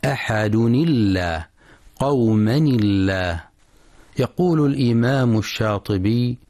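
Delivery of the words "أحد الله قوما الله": "أحد الله" linked as ahaduni-llah, light L; thin lam in linking. Shown as a demonstration. Teacher demonstrating tanween joined to God's name, thin lam. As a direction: slip a ni before "الله" and keep its L thin. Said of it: "اللام في لفظ الجلالة مرققة عند الوصل".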